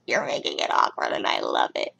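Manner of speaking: lightly voiced low murmur; low mock reply. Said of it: "And I'm like".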